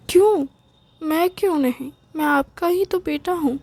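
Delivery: high, loud hurt protest; indignant outburst. Acted. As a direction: throw the why back at them, stung. Son indignant at parents' answer.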